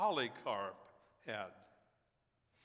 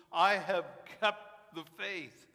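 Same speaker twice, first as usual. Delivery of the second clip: slow preaching; 5 semitones higher, 9 dB louder, slower than usual. Acted. Preacher quoting Paul, slow.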